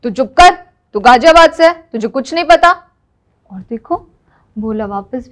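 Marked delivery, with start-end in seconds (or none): 0.0-2.7 loud, high scolding outburst; angry silencing shout. Acted. Husband snapping at wife to shut up, angry shout. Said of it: "तो पता है ये हमसे क्या कहे?".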